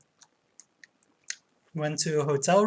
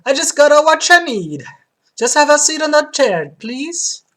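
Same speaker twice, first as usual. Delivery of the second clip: high, loud Italian barber; Italian accent; 10 semitones higher, 11 dB louder than usual. Acted. Barber welcoming a customer, Italian accent. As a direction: play an exuberant Italian barber. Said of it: "platinum blonde with Italian accent".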